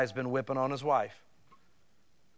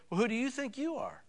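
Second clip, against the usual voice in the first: indignant retort; 9 semitones higher, 6 dB quieter than usual. Retold or imitated. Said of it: "sometimes people think".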